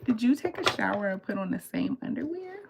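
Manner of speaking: mildly acted horrified question; incredulous question. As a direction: ask it high, appalled. Disgusted question to boyfriend, retold.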